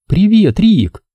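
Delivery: lightly voiced girl, sweet hello; soft girl voice. A narrator's reading. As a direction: Katie voiced high and gentle. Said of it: "мягко прошептала Кэти".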